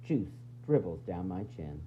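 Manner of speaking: ordinary delivery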